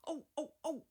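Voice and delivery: high, loud startled cries; screaming trucks. A narrator's reading. Silly trucks jolted by Edward's sudden pull, high loud startled squeals. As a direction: squeal in shock as you're yanked. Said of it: "they cried again".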